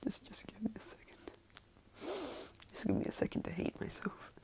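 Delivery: near-whispered embarrassed aside; quiet self-reproach. Real, not acted. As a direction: drop to a very soft, breath-only mutter to yourself. Embarrassed aside mid-story.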